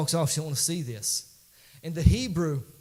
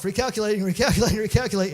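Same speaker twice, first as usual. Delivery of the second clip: higher, insistent mock GPS repeating itself; raised-pitch imitation of a nagging GPS; mimicked GPS; 7 semitones higher, 6 dB louder, faster than usual. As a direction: pester like a recalculating GPS, pitched up.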